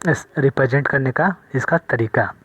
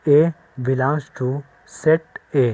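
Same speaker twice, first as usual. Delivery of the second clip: slow, drawn-out reading aloud; slow model reading; much slower than usual. Shown as a demonstration. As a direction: show the class how it is read. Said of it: "या फिर ऐसे पढ़ सकते हैं".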